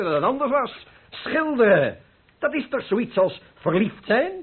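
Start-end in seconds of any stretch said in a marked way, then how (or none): none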